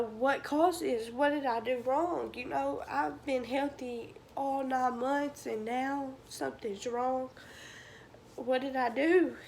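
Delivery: retold anguished questioning. Mother retells asking what she did wrong, anguished questioning. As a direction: relive asking what went wrong, voice lifted.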